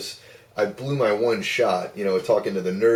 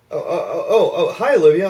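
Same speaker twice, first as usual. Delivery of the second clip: high, loud, tongue-tied greeting; flustered stammer; 10 semitones higher, 9 dB louder than usual. Retold or imitated. Starstruck caller greeting the hostess, hammed-up flustered stammer. Acts out being flustered on phone.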